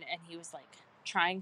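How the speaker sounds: normal talking voice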